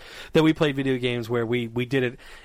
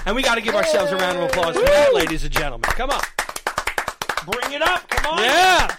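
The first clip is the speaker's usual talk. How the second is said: high, loud hype-up for applause; rally the room to cheer; raised, whooping excitement; rousing cheer; 8 semitones higher, 5 dB louder than usual. Real, not acted.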